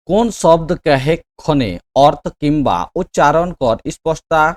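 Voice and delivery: slow, emphatic reading aloud; measured, projected classroom dictation; quiz question read-out. Real, not acted. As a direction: read the question out slowly and firmly. Reads exam question aloud.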